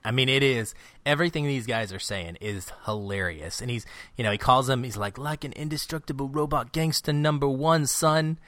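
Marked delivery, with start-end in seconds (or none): 5.2-8.4 higher gangster quote voice. Retold or imitated. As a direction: ham up a tough gangster. Quoting a gangster line.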